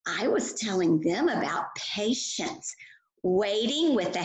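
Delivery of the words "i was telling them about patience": ordinary talk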